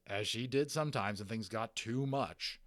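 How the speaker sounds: usual speaking voice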